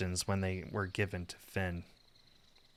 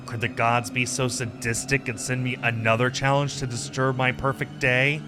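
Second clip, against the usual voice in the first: usual speaking voice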